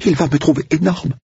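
put-on high, fretting young woman; anxious young woman. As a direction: voice Manon high and worried. Manon worried.

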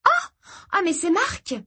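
put-on high girlish exclamation; excited, surprised young sister; delighted surprise. A narrator's reading. Girl recognises her friend.

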